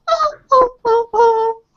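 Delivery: loud theatrical sobs; sobbing. Acted. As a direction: wail like a weepy bean. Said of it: "shudders a little and starts going".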